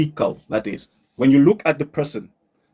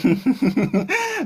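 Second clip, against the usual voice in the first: real high-pitched laugh; 9 semitones higher than usual. Real, not acted. Teacher amused by a student's attempt, high laugh.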